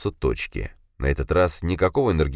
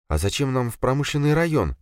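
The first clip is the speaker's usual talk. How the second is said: put-on higher, wary young woman's voice; slightly tense girl; 9 semitones higher than usual. A narrator's reading. Girl tensely asks Dima why they're going there. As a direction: voice her rising unease. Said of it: "слегка напряглась девушка".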